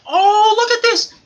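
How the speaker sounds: high, loud thrilled gasp; excited. Retold or imitated. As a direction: gush with delight at the match. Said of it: "I was like".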